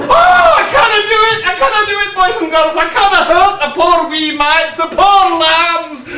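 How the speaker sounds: theatrical mock distress; mock anguish. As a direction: lament it like a pantomime dame. Dame laments order to cook children.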